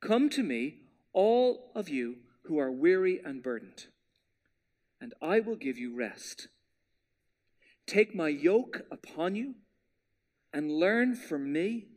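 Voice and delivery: slowed-down Bible reading trailing to near whisper; slow careful reading. Shown as a demonstration. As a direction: read each phrase slowly and gently, letting it sink in. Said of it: "I'm gonna slow down just a pinch".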